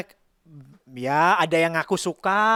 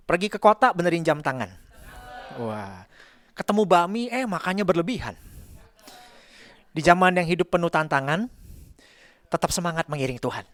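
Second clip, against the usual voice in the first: pantun recital; 2 semitones higher than usual. Acted.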